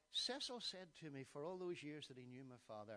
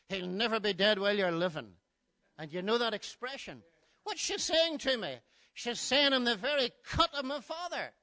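loud pulpit delivery, pitched higher; full-force preaching; 7 semitones higher, 18 dB louder than usual. Acted. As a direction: proclaim it to the hall. Preaching the mother's words.

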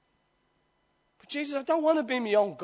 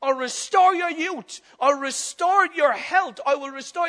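voicing Jesus; 6 semitones higher, 6 dB louder than usual. Jesus voiced in sermon.

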